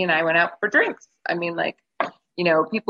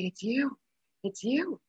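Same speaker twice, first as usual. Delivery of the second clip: crying; 7 semitones higher, 7 dB quieter, slower than usual. Retold or imitated. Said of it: "I start crying".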